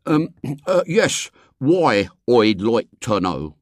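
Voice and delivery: put-on laboured Cockney accent with hesitations; copied street accent. A narrator's reading. William imitating his friends, effortful put-on accent. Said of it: "redoubled his efforts to acquire his friend's intonation".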